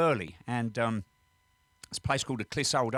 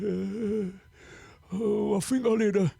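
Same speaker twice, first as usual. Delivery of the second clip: hesitant patient; 8 semitones higher, much slower than usual. Acted. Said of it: "He said".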